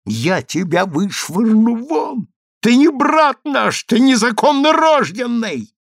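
high, stretched-out furious outburst; terrible rage. A narrator's reading. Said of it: "пришла в страшную ярость".

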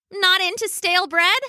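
high, teasing duck voice; chirpy duck. A narrator's reading. The duck teases the grumpy dog, high and playful.

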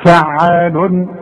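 فعال said very slowly, with the final un of the tanween sounded out clearly; slow teaching demo of فعال showing where the tanween sits; tanween shown. Shown as a demonstration. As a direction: stretch فعال and land clearly on the nunation at the end. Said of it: "عشان أظهر لك فين التنوين أقول لك".

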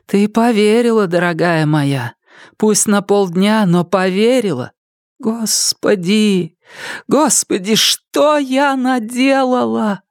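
high, drawn-out anguished lament; anguished self-reproach. A narrator's reading. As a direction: reproach yourself in a high, stricken voice. (горестно, с отчаянием, высоким голосом)

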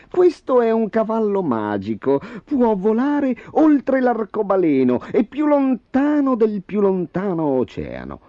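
high-pitched, sly dwarf showing off; malicious dwarf. A narrator's reading. Dwarf boasts of the magic horse to the king, high and sly. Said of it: "rispose il nano con una smorfia maligna".

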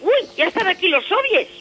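high, loud mock alarm; playful fright at the soviets. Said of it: "y digo". (falso susto exagerado)

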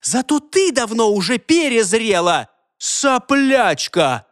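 high, slowed insults hurled back; indignant quarrel. A narrator's reading. Two rivals trading insults, indignant. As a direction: spit back each insult, pitched high. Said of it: "задохнулась от возмущения".

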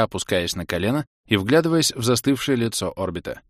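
plain reading voice

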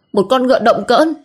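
the insult flung upward in a high voice; shrill rising insult. A narrator's reading. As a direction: let the insult climb up high with scorn. Diệu insulting Hến.

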